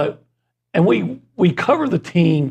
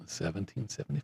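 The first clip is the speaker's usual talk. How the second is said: very soft, low, half-hearted creepy voice; trying out a creepy murmur; attempted creepy voice; 5 semitones lower, 19 dB quieter than usual. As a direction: say it hushed and low, like a creepy guy. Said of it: "in that voice you did earlier".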